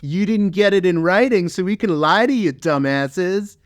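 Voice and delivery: high taunting put-on voice; mocking sneer. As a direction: sneer it like a smug liar. Mocking the US excuse.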